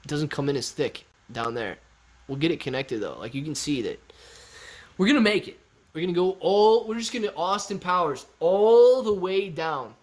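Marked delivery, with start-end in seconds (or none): 5.0-9.9 hyped up. Real, not acted. Hyping beard plan.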